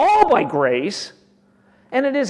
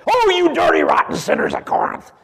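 loud, high mock rebuke; mock scolding; 8 semitones higher, 6 dB louder than usual. Preacher mocking how God might scold the Corinthians, loud. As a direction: bark a sneering mock scolding. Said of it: "Is that how he says?".

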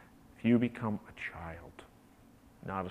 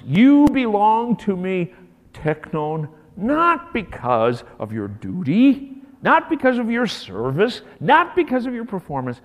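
loud, high, building repetition; impassioned father; 10 semitones higher, 14 dB louder than usual. Acted. Father declaring to his son before the village, impassioned shout. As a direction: plead it out at full force. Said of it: "and says".

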